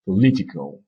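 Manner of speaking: "political" with LI stressed; "political" said as po-LI-ti-cal; stress on second syllable. Shown as a demonstration. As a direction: hit LI in "political", keeping the first syllable weak. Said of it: "L'accent est sur la deuxième syllabe".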